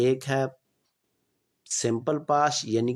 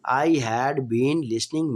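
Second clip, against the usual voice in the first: slow, clear dictation pace; slow classroom example sentence; slow example read; much slower than usual. Shown as a demonstration. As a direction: spell it out slowly for students. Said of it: "teacher reading tense example".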